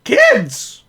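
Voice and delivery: high, loud outraged cry; shocked outburst. Real, not acted. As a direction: exclaim in disbelief. Shocked at blood in kids' film.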